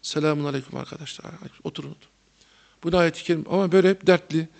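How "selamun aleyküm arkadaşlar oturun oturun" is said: a hint of a weary, sorrowful hodja greeting softly; gloomy teacher. Teacher greeting his class, lightly voiced sad and soft. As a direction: mope through the greeting like a sad old teacher. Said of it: "devamlı suratı böyleydi".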